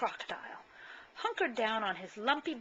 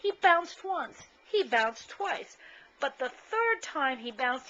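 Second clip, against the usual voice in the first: higher, slow, bouncing read; bouncy storytelling; 5 semitones higher, 5 dB louder, slower than usual. A narrator's reading. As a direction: let each bounce land, slowly. Narrates bouncing with build.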